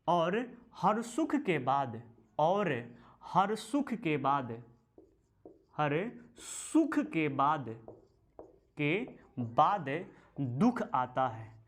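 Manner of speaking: each phrase drawn out slowly, सुख stressed; slow, deliberate teacher dictation. Shown as a demonstration. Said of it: "dictating the sukta again".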